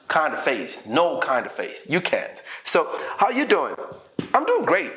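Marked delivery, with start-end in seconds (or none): 3.2-4.9 high, lively demo exchange; upbeat small talk acted for learners; cheerful. Shown as a demonstration. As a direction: answer brightly, pitch jumping up. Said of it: "make sure that you're cheerful".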